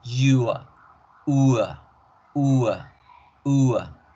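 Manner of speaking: ua with the schwa sounding like a; teacher drilling the ua sound; ua diphthong drill. Shown as a demonstration. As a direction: drill ua over and over. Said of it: "El diptongo u con schwa".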